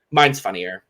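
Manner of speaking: low, flat put-down; dry one-upping retort; unimpressed mock retort; deadpan. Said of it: "looks her dead in the eye and was like".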